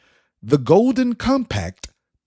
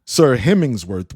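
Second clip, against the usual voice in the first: usual speaking voice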